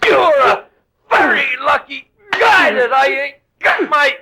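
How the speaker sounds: high, loud slow shout; furious yelling at the man who betrayed him; angry outburst. Acted. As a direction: bellow the threat with fury.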